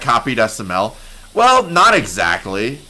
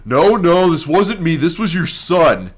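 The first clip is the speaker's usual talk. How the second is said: argument voices; 7 semitones higher, 4 dB louder than usual. Acted. Acted quarrel, parent and son.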